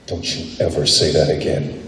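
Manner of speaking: low, quiet menace; genuinely felt anger. Shown as a demonstration. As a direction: drop low and soft, a real threat. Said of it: "It's not the same as if I look at you and say".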